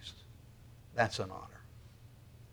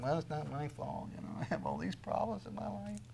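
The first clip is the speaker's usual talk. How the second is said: softer, mock self-pitying whine; whiny victim; 12 dB quieter than usual. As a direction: imitate someone dodging blame. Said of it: "everybody wants to feel like they're a victim".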